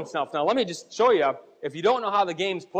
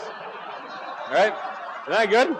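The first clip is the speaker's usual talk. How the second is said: ordinary talk, nothing marked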